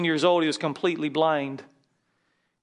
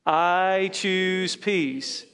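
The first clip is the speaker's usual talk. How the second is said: each word stretched long and even; very slow recitation; 3 semitones higher, much slower than usual. Shown as a demonstration. Pastor leading the room in slow repetition. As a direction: draw "I choose peace" out slowly.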